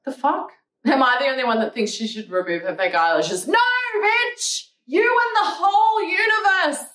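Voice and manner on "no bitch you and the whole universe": sassy yell. Real, not acted. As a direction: shout it down at the hater. Answering a rude comment.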